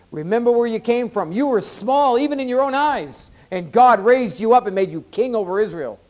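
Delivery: high, loud, urgent exhortation; full-force preaching. Real, not acted. Preacher voices Samuel's reminder to Saul, full-force preaching.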